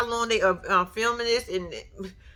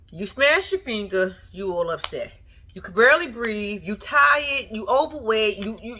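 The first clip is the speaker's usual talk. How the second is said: normal talking voice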